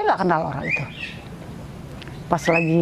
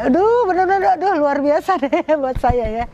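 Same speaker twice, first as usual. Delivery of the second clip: high, louder, laughing exclamation; real overjoyed gushing; 9 semitones higher, 4 dB louder than usual. Real, not acted. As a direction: gush with delight, a laugh breaking in. Overjoyed recounting meals with her children.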